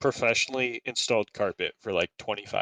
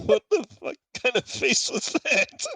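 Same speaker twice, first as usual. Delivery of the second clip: words squeezed out through laughter; can barely speak for laughing; 14 semitones higher, 3 dB louder than usual. Real, not acted. Cracking up at a friend's face.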